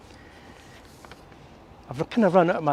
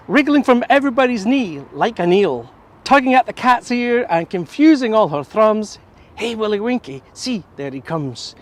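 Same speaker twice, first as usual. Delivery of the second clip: poem recitation; 3 semitones higher, 3 dB louder than usual. Shown as a demonstration. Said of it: "I'll do it in English now".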